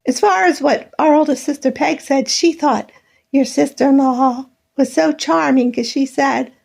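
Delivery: ordinary talk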